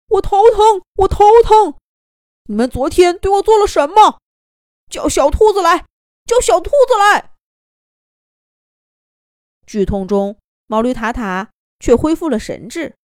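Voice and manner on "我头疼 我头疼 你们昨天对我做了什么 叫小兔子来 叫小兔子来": loud, high shouting through a headache; crying out in pain. A narrator's reading. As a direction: howl it while clutching your head. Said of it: "痛苦地叫起来". (痛苦地大叫)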